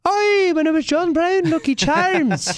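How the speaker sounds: high, loud Northern Irish dad voice; Northern Irish accent impression. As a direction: ham up her dad's Northern Irish lilt. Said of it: "Her dad is like".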